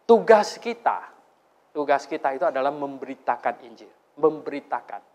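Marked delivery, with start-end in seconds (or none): none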